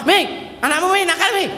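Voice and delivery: high complaining call to wife. Retold or imitated. Calling wife about naughty child.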